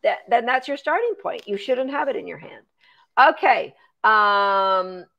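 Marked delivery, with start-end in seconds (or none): none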